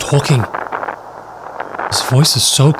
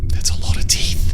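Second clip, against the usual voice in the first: whisper; 3 dB quieter than usual. Real, not acted. Creature's teeth seen up close.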